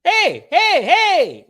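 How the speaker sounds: high, loud indignant outburst; hammed-up angry yell; mad uncle. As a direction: cut in shouting, like a pissed-off uncle. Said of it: "he's, like, getting mad".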